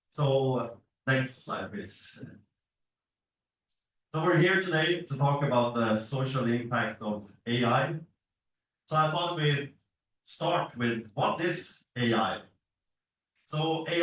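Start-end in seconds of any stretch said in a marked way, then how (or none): none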